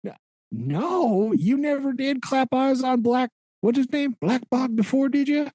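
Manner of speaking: high, pointed questioning; mock interrogating pirate. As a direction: lead the witness with fake outrage. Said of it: "He's like".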